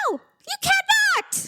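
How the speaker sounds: very high, drawn-out protest; slow, squealed mock refusal; imitated protesting daughter. Daughter forbidding mom a boyfriend, imitated high protest.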